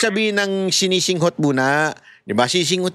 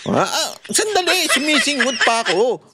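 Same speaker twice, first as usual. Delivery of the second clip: mock busy sniffing; 7 semitones higher than usual. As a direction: act out a man busy sniffing a cake. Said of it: "Sabi niya".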